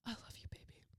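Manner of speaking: hushed mock-sappy whisper. Mocking a sappy boyfriend at dinner, whispered. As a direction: breathe it like a besotted lover.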